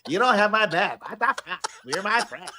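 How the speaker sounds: put-on high Valley Girl squeal through giggles. Playing Pat betrayed by friend Adrian, mock Valley Girl. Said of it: "Your Valley Girl impersonation".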